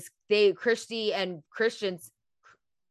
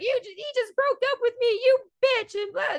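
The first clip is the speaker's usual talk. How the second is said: put-on high, shrill-pitched accusing mean girl; jealous ex snapping at Romy; hammed-up high Christy voice; mean-girl accusation; 14 semitones higher than usual.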